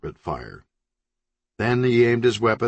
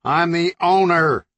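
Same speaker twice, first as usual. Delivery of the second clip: higher, louder indignant yell; 8 semitones higher, 5 dB louder than usual. Acted. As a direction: assert it through the wall. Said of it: "yelled Zaragoza".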